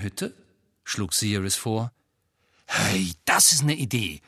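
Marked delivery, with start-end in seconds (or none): 2.7-4.2 lightly voiced delight; pleased at the idea. A narrator's reading. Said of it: "sagte Ron entzückt". (entzückt)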